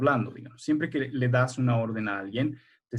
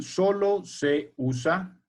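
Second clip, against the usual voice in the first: slow, deliberate dictation pace; teacher dictating a note, slow; 3 semitones higher, much slower than usual. Shown as a demonstration. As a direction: say it slowly so the class can copy it down.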